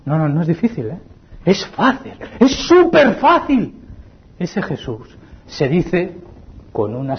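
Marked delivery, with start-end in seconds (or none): 1.4-3.8 climbing higher, slowed on súper fácil; rising to emphatic. Acted. Preacher to congregation, slow rising emphasis. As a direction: stretch it out, rising. Preacher builds on repeated phrase.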